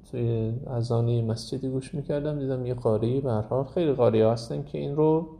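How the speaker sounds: ordinary talk, nothing marked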